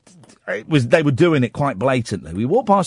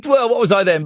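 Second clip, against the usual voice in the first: normal talking voice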